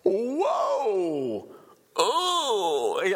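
high, drawn-out cries of shock; hammed-up high scream of alarm; mock screaming. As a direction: wail "whoa" like a startled onlooker. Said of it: "I could see people screaming".

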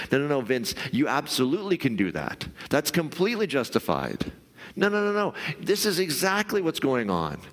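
the inner voice insisting you're right, hammed-up; mock insistent inner voice. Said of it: "voice of the bullshit voice inside of your head that's going".

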